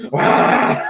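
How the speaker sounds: sudden mock pug yelp; pug scream. Retelling the pug screaming at the puppy, sudden yelp. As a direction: blurt a short dog scream. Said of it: "just does this puglet".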